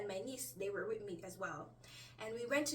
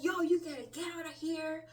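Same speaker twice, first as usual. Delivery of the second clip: mildly imitated angry order, pitched up; mock upset man ordering someone out; 5 semitones higher, 4 dB louder than usual. Upset date throwing her out in a retold dream, mildly angry. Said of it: "this man got so upset".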